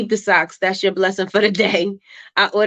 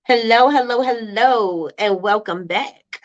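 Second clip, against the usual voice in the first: host greeting; 3 semitones higher, slower than usual. Real, not acted. Greeting meeting attendees.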